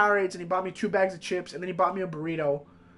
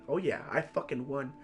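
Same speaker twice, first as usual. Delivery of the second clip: soft, lower, smug gloating; mock smug teacher; 6 semitones lower, 10 dB quieter than usual. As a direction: imitate her quiet self-satisfied gloat.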